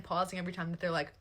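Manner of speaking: ordinary delivery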